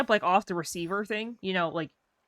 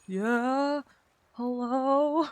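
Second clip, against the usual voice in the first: higher, flustered, still calling hello; nervous hello; 7 semitones higher, much slower than usual. Retold or imitated. Nervous applicant reassuring the interviewers, higher and flustered. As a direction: stay rattled and keep calling into the phone. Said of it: "I would always get so nervous".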